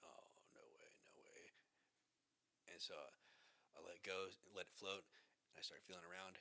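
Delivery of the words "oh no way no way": hushed whisper of no way; whispered disbelief. Retold or imitated. Retelling the dive, whispering stunned disbelief. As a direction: breathe it out in awe.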